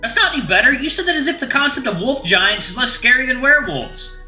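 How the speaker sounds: high-pitched agitated protest; exasperated arguing. Acted. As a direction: press the point insistently. Agitated arguing with wolf giant.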